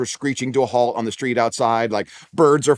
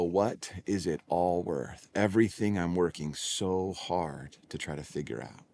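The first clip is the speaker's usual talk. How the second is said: softer and slow, searching; weary pondering; 5 semitones lower, 10 dB quieter, slower than usual. Retold or imitated. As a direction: ask it like the weight of a lifetime. The question everyone asks.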